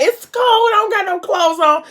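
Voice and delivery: very high-pitched mock whine; squealed protest. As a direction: squeal it like someone about to hit snow. Acted squealing protest in the snow.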